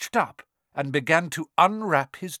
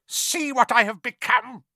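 high, loud, eerie lament; sinister villain face; 9 semitones higher, 5 dB louder than usual. A narrator's reading. Face showing itself to Harry, high and sinister. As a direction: declare it high, with menace. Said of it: "the face said".

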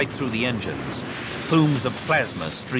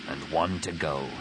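gritted; 6 semitones lower, 6 dB quieter than usual. A narrator's reading. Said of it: "he gritted".

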